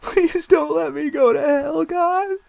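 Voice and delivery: very high, loud whining plea; cracking whine. Acted. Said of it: "his voice crack into a whine".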